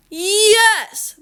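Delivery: high, drawn-out shout back; stubborn insistence. A narrator's reading. Cashier insisting the father pay, stubborn shout. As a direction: fire it back high and long.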